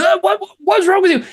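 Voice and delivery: high-pitched, stammering mock outrage; upset young man. Younger guy at the crash confronts the driver, mock upset. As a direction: imitate someone sputtering in high-voiced disbelief. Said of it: "he was so fucking upset at me".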